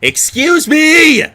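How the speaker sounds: high, loud, drawn-out shout. Acted. Girl interrupting two bickering men, shouting. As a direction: stretch it out and yell to cut in. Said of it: "I shouted into the middle of them".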